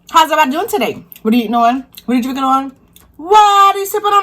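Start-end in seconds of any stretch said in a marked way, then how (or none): 0.1-1.0 excited greeting. Real, not acted. Upbeat greeting to viewers. 3.2-4.2 playful high call. Real, not acted. Playful question to viewers.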